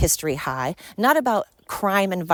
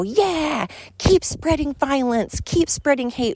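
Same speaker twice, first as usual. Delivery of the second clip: much higher, sneering mock cheer; mocking liberals; 8 semitones higher, slower than usual. Mocking liberals cheering Kimmel on, higher sarcastic voice. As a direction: parody them with a sarcastic sing-song. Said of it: "liberals get on there and go".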